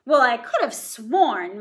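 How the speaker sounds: slow muddled giant. Acted. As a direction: mumble it in confusion. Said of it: "muddled the ogre".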